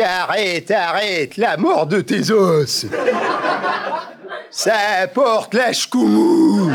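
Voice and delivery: put-on slow, laughing pied-noir accent; pied-noir oath, half-laughing; slowed-down pied-noir superstition; De Gaulle with pied-noir accent. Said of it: "l'accent pied noir".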